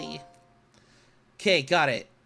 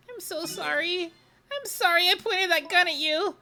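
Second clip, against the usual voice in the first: Camila apologizing; 8 semitones higher than usual. Acted.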